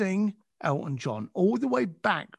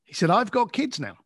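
usual speaking voice

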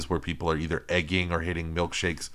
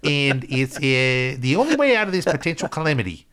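loud, high mock Kiwi accent; bad New Zealand accent, playing it up; 8 semitones higher, 4 dB louder than usual. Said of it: "I'm doing New Zealand accent".

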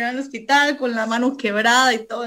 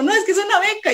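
high, explaining as Gaby; lightly voiced caller, patient; a hint of Gaby insisting; voicing Gaby; 9 semitones higher, faster than usual. Gaby voiced in retold call.

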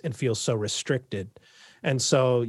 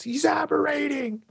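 man down shout; 14 semitones higher than usual. Pretend. Time crew alarm from the show.

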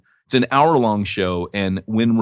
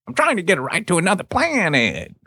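put-on kid voice; 8 semitones higher than usual. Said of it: "where they're like".

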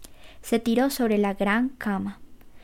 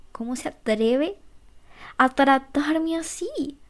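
rising anger; 5 semitones higher, slower than usual. A narrator's reading. Gaby fuming to herself over Jerry's lateness, a hushed slow rising anger. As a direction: whisper building to a slow, higher, indignant line. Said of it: "el temor de Gaby se convirtió en ira".

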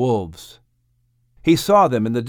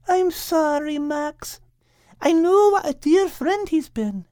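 very high-pitched, consoling character voice; sympathetic. Acted. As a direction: offer condolence in a high, tender voice. Kate consoles Max over losing friend.

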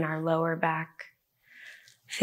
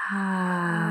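open-mouthed exhale. Acted. Collective exhale through mouth.

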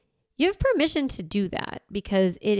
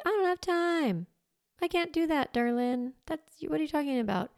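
mock protest; 7 semitones higher than usual. Mocking a busy listener's excuse.

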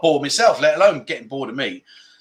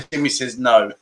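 ordinary talk, nothing marked

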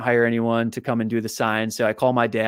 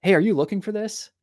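higher-pitched stranger calling up; voice from below; 8 semitones higher than usual. Retold or imitated. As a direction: call up lightly with a rising question. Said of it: "I just hear this voice go".

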